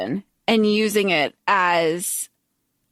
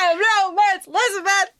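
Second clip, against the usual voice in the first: higher pitched mock royal declaration; hammed-up high defiant queen; 8 semitones higher, faster than usual. Repeating the queen's line to her cohost in a high mock voice. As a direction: camp it up as an outraged queen.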